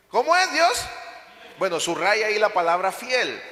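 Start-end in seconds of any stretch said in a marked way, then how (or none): 0.1-0.9 rhetorical question. Acted.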